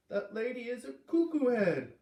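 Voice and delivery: put-on high, loud, upset boy's voice; a small boy blurting out his outrage; upset little boy. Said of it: "Luke said".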